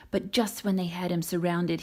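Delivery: ordinary delivery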